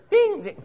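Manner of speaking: high piercing bell ding; ping like a flight call bell; high mock bell chime. Pressing call button.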